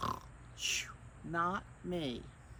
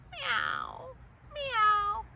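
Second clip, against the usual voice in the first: high, loud playful meows; cat meowing; 7 dB louder than usual. Pretend. Reading to children, meows to hint the cat. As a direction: mew like a kitten for the kids.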